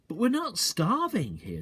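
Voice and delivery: put-on high young boy's voice; lightly voiced boy Danny; child's voice. A narrator's reading. Danny voiced in read story.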